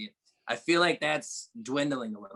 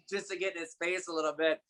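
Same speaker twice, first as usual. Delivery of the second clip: ordinary delivery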